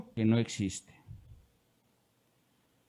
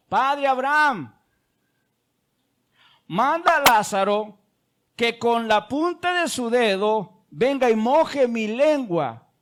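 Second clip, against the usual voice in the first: pleading cry; 7 semitones higher, 3 dB louder, slower than usual. Retold or imitated. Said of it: "le gritaba al padre Abraham".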